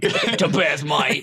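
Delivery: Cockney tough-guy voice, amused; Jason Statham voice. Retold or imitated. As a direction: copy a Statham London accent. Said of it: "doing a Jason Statham voice".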